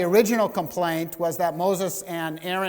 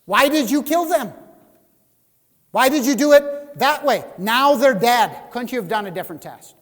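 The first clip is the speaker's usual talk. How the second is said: loud, much higher indignant questions; complaining crowd; 8 semitones higher, 10 dB louder than usual. Retold or imitated. People complain to Moses and Aaron, loud indignant questioning. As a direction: demand answers in outrage. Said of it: "looking at Moses and Aaron and saying".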